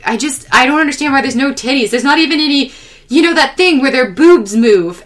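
mock bafflement, pushing to be understood; enthusiastic dad. Dad explaining his anime grievance to Jesse, worked-up enthusiasm. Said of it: "he was so enthusiastic about".

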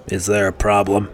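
a lightly voiced barkeep; gruff barkeep Orgnar. A narrator's reading. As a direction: ask flatly, barkeep style. Orgnar asking.

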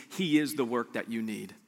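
ordinary delivery

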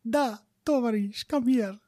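Russian wife, hammed up; mock Russian accent. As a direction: beckon in a fake Russian accent.

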